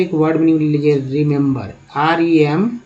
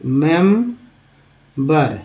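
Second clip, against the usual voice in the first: Remember stretched out very slowly, syllable by syllable; slow syllable demo; much slower than usual. Shown as a demonstration. Teacher breaks the word down for students, very slow. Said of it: "इस तरह से लिखेंगे".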